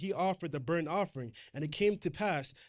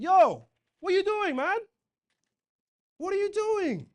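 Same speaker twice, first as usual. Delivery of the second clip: much higher, louder outraged yell; indignant shout; 9 dB louder than usual. Acted. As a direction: shout it at the disobedient king. Samuel confronting Saul angrily.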